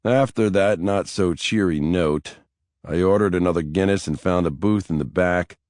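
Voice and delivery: usual speaking voice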